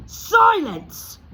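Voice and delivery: high, loud yell. Acted. Uncle Vernon to Harry, a loud yell. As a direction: shut him up with a bellow. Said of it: "yelled Uncle Vernon".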